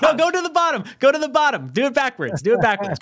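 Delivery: high-pitched, laughing and insistent; excited laughing urge. Real, not acted. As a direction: laugh while urging them to count from the end. Urging co-host with number list.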